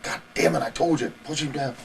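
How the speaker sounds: mock angry outburst, pitched high; freaked-out outburst. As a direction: imitate a trainer losing his temper, voice jumping up. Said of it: "Ole freaked out".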